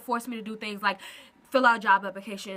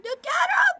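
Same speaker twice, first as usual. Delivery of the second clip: high, loud delighted squeal; excited squeal; 6 dB louder than usual. Real, not acted. Gushing over her dog's YouTube debut, squealing. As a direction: squeal over a cute pet. Showing off the dog.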